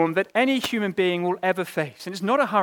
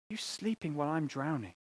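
quiet despair; 8 dB quieter than usual. Retold or imitated. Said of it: "you cry out to God".

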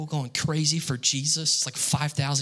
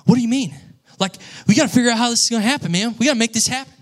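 pushy excited friend; 8 semitones higher, 6 dB louder, faster than usual. Retold or imitated. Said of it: "And he was like".

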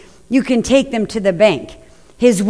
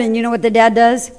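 ordinary talk

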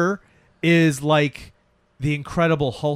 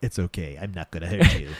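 distinguished gentle voice; 8 semitones lower than usual. Retold or imitated. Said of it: "So he's like".

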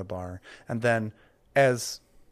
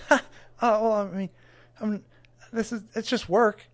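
higher, stammering, nervous helper; flustered Trevor; 10 semitones higher, 6 dB louder than usual. Acted. Trevor flustered by the huge cleric, higher and stammering. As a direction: babble nervously up in pitch.

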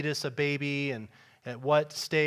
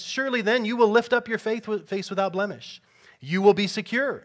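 higher, louder proclaiming of promises; scripture reading; 4 semitones higher, 6 dB louder than usual. Acted. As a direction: declare each you will. Reads scripture aloud.

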